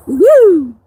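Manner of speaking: loud happy whoop; excited woo. Real, not acted. Streamer cheering at a viewer's message, loud whoop.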